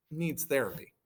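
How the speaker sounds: usual speaking voice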